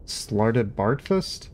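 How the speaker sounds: very slow, questioning repeat rising at the end; drawn-out incredulous question; disbelieving echo. A narrator's reading. Arthur echoes the name in disbelief.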